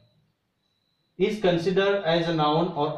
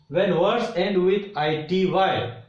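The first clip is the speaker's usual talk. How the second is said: ordinary talk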